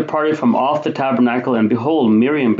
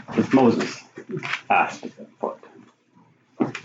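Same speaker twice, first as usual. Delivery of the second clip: hushed; 6 dB quieter, much slower than usual. Real, not acted. Dramatic hush on the reason.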